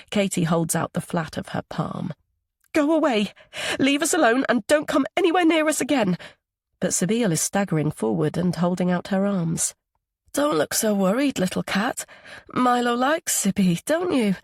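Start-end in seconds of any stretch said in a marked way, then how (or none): 2.7-6.2 high, loud, desperate yell to drive her off; frightened shout. A narrator's reading. Katie yells at drunk Sabine to go away. 10.3-14.3 lightly voiced tipsy sing-song, higher; a hint of a drunk woman cooing at the boy; drunk coaxing. A narrator's reading. Drunk Sabine coos at little Milo, lightly voiced.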